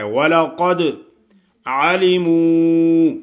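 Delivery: وَلَقَدْ with a heavy ق and a bouncing echoed د; qalqala on dal. Shown as a demonstration. Teacher models qalqala in وَلَقَدْ. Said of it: "دال پہ قلقلہ ادا کرنا ہے".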